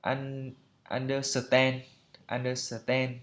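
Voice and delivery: understand as even "un-do-suh-ten"; unstressed, syllable-by-syllable "un-do-suh-ten" for understand; Vietnamese-style mispronunciation. Shown as a demonstration. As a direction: break understand into Vietnamese syllables with an extra vowel after S. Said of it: "phiên âm Việt Nam như là".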